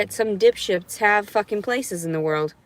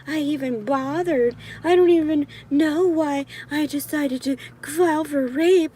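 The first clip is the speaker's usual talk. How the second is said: high-pitched sarcastic put-on voice; condescending voice; 6 semitones higher than usual. Speaker mocking disbelief of their report, condescending. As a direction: pitch it up and mock with false self-blame. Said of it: "that's the kind of condescending voice I do to men".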